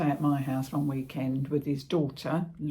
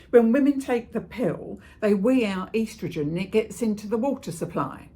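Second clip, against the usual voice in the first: loud, higher-pitched know-all explanation; opinionated mother lecturing with raised voice; ranting; 4 semitones higher, 8 dB louder than usual. Acted. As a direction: explain the theory loudly, utterly sure of it. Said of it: "I was having one of me rants".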